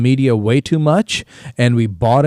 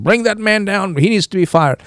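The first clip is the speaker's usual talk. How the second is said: lightly voiced mob demand, higher pitch; angry mob; 7 semitones higher than usual. Mob rushing to parliament demanding a firing, mock outcry. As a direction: raise the pitch like a crowd calling for a sacking. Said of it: "that mob that is rushing ... and saying".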